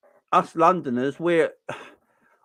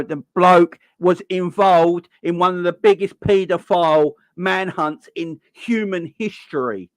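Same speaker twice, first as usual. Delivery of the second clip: normal talking voice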